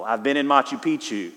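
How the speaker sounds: ordinary delivery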